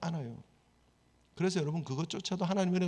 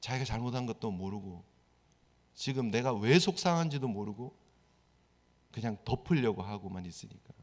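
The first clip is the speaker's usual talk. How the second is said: normal talking voice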